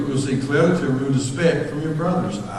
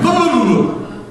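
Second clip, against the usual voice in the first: high-pitched rapid gibberish babble; speaking in tongues; 12 semitones higher, 4 dB louder, slower than usual. Retold or imitated. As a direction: imitate a geek talking too fast to follow. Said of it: "you sound like you're speaking in tongues".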